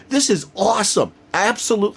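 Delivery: high-pitched fan elation; retold hoorahing. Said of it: "I was hoorahing".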